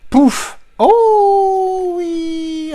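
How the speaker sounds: squeaky, very high "oh oui"; mock delight. Vlogger opening a mystery Amazon parcel, squealing very high. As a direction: squeal it like a thrilled kid at a parcel.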